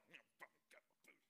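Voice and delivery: mock mutter under the breath, soft and fast; grumbling under breath. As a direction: grumble it to yourself at the table. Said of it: "You're like".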